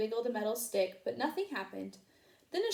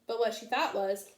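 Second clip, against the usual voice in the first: ordinary delivery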